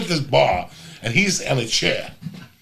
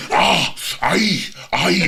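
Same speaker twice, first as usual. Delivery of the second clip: mock moans, a bit higher; imitated moaning noises; 5 semitones higher, 3 dB louder, much slower than usual. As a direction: ham up pleasure noises. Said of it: "He's going, ... going through the noises".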